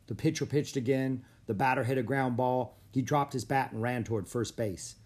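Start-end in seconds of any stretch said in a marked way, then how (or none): none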